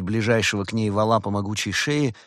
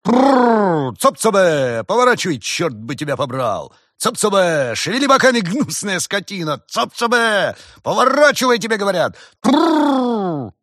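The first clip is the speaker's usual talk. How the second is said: very high, loud ox-driving holler; piercing shout; 11 semitones higher, 9 dB louder than usual. A narrator's reading. Farmer yelling at his oxen, piercing and angry. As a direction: bellow commands with rolled trills. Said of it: "услышали пронзительный голос".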